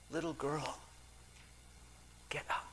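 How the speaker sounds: put-on softly, gently, "Little girl, get up"; tender. Jesus speaking gently to the sick girl, softly. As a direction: coax her up with quiet tenderness.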